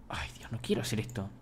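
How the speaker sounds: whispered dread under the breath. Real, not acted. Streamer dreads the task, whispering to himself. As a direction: breathe it out, not wanting to do it.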